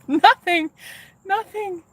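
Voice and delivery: high, laughing and teary; tearful laugh. Real, not acted. Telling the guy she's fine, high tearful laugh. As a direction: giggle it away through tears. She reassures him, laughing-crying.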